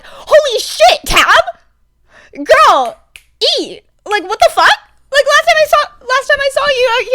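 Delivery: high, loud hyped-up yelling at a friend; shrieking with delighted disbelief; excited shout. Retold or imitated. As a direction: scream it like you can't believe what she just did.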